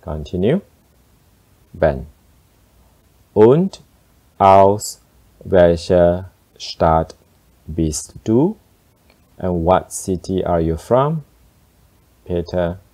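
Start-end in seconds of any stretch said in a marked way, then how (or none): 3.3-8.6 slow with a pause between words; slower. Shown as a demonstration. Teacher slows down for learners. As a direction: lay out each word slowly. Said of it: "I'll speak slower".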